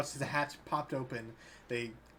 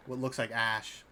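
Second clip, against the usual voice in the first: ordinary delivery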